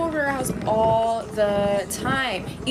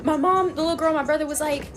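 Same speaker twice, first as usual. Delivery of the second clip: ordinary delivery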